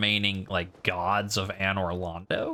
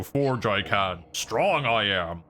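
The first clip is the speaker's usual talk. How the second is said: slow, lumbering giant speech; echoing giant blacksmith; much slower than usual. Retold or imitated. Streamer echoing the giant blacksmith's lines, slow and lumbering. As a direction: drag it out like a dim, friendly giant.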